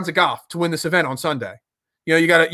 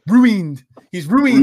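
louder cartoon baby voice, lightly done; a hint of a scheming cartoon baby; Stewie Griffin voice; 2 semitones higher, 5 dB louder, much slower than usual. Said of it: "in my best Stewie Griffin voice".

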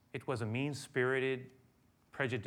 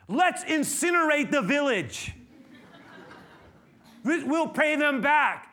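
angry disciples; 14 semitones higher, 12 dB louder, slower than usual. Retold or imitated. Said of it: "I mean, they're saying".

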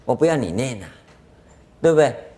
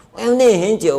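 high, louder complaint; whiny student; 9 semitones higher, 5 dB louder than usual. Retold or imitated. Student protesting to the master, high and whiny. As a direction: whine that it takes forever.

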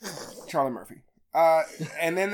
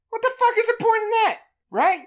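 ordinary delivery

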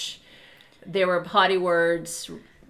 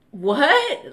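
retold shock; 7 semitones higher, 7 dB louder than usual. Reliving shock at the news.

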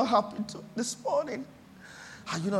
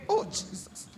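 emotional; 12 semitones higher, 7 dB quieter than usual. Real, not acted. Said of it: "I feel emotional about this".

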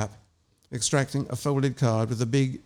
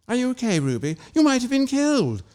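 alarmed concern; 10 semitones higher than usual. Acted. Margot alarmed at hospital bed.

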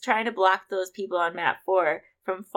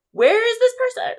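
retold call; 7 dB louder than usual. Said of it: "We're like".

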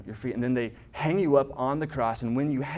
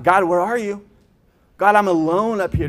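higher, louder cry of being abandoned; desperate cry; 7 semitones higher, 9 dB louder than usual. Retold or imitated. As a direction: call out to God as if left alone.

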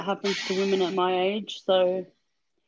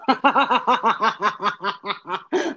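sinister laugh. Real, not acted. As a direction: cackle like a cartoon villain. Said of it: "I love that sinister laugh of yours".

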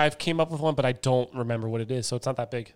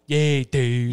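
drawn-out, louder lazy Delco drawl; lazy Delco talk; 5 dB louder than usual. Shown as a demonstration. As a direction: stretch it out in lazy-lipped Delco. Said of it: "it's like a lazy talk".

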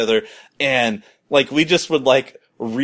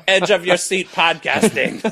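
laughing echo; 5 semitones higher than usual. Real, not acted. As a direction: repeat it while laughing. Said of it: "This is riveting entertainment here".

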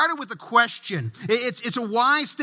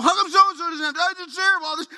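put-on whining child; 7 semitones higher, faster than usual. Kid complaining to dad about a sibling, high whine.

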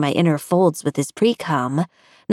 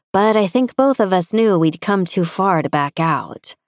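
ordinary delivery

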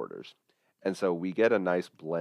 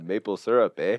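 mock Canadian; 2 semitones higher, 4 dB louder than usual. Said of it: "I don't know what accent that was".

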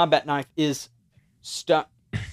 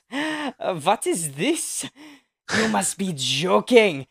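high, slow, scornful vampire sneer; mock the hero's Bible trick, slow and haughty; vampire villain voice; 8 semitones higher, 6 dB louder, slower than usual. GM voicing Von Kruger, mocking the Bible, high and slow. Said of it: "all you hear is".

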